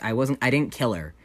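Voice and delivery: usual speaking voice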